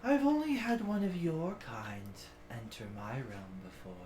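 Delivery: lightly voiced ancient guardian; ancient creature voice. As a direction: speak as an old ominous creature.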